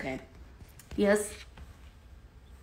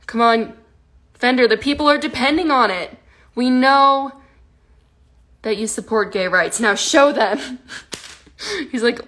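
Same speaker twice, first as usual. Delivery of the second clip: ordinary talk, nothing marked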